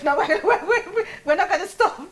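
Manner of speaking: high, loud laughter through the words; host laughing as she interrupts the guest. Real, not acted. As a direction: laugh while cutting the guest off. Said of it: "Okay, I've got to stop you there. laugh".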